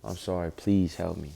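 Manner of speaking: low, subdued pleading prayer. Retold or imitated. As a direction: retell a hushed plea for help, pitched low. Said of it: "is pray".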